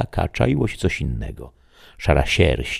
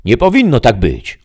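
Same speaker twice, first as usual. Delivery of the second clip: much higher, louder indignant protest; 9 semitones higher, 9 dB louder than usual. Acted. The shadow protests to the voice, much higher and louder. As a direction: reject it outright. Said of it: "obruszył się cień".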